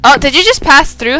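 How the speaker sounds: usual speaking voice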